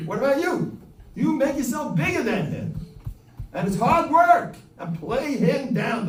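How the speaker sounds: high, loud push on the listener; fired-up preacher urging; rising exhortation. Real, not acted.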